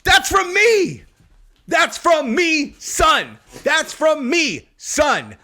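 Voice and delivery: high, loud and slow, savoring each word; triumphant boast. Real, not acted. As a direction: crow about it with delight. Boasting that the clip quotes him.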